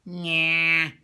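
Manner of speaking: loud nasal whine through the nose; Bugs Bunny. Shown as a demonstration. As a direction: push the sound out of your nose, loud and drawn-out. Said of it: "Bugs Bunny will say".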